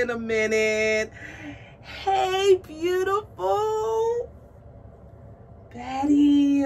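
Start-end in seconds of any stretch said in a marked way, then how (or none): 1.8-6.6 high, loud, drawn-out coo; adoring squeal. Real, not acted. Greeting her beautiful new doll, adoring squeal.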